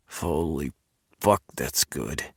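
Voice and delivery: low, soft growl of pleasure; a low growl, half under the breath. Acted. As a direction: drop the voice and growl it out. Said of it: "he growled".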